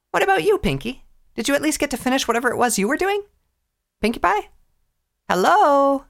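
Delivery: brash pony calling. Acted. Dash calling Pinkie.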